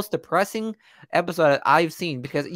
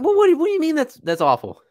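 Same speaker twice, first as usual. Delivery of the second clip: much higher, louder disbelief; can't believe what he's hearing; host pushing back on his friend, incredulous; 12 semitones higher, 6 dB louder than usual. Real, not acted.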